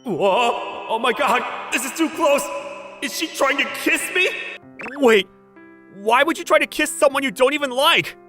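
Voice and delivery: high, flustered freak-out; startled and flustered; panicked surprise. Acted. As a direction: blurt it out, pitch shooting up in alarm. Startled, near-kiss from date.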